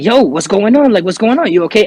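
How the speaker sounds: retold worried questions, pressing for an answer; urgent concern. Coworker checks on a man who froze, retold alarmed concern.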